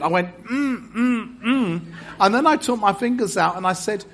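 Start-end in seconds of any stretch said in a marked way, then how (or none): 0.4-1.8 mock effort grunts, pitched high; showy straining grunts. Trying to squeeze fingers into a tiny sleeve, hammed-up grunts. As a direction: make a big show of straining. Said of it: "I made a big show of it".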